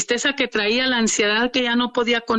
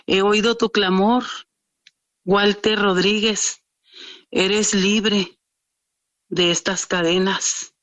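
voice from heaven; 3 semitones lower, slower than usual. Retold or imitated. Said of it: "se escuchó una voz".

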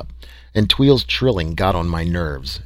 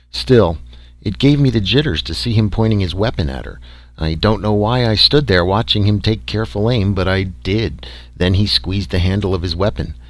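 plain reading voice

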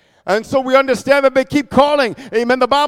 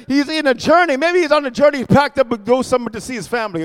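ordinary talk, nothing marked

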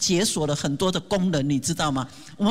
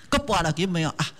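normal talking voice

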